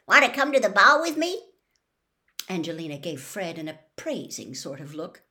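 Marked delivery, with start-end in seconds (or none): 0.1-1.4 loud, high call across the room. A narrator's reading. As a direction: ask her out loudly in front of everyone. Calling across common room.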